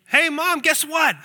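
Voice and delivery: put-on high, loud burst of kid's joy; child bursting through the door thrilled; joyful exclamation. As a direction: bounce in with big news. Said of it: "with this great joy and exclamation saying".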